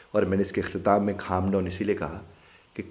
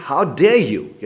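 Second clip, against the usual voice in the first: loud, slow How dare you with stress on dare; outraged demand; 8 semitones higher, 10 dB louder, much slower than usual. Shown as a demonstration. Man confronts another, outraged and loud. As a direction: challenge him, slowly and hard. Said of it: "अब जो ही उसने कहा कि".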